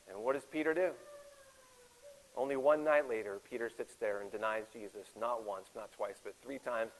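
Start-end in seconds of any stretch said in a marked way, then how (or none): none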